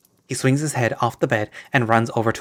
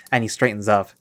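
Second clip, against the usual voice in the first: plain reading voice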